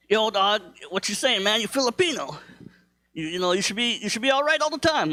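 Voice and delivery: high-pitched mock street-buddy voice; imitating thug friend. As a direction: put on a thug pal's high voice. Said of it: "my thug friends".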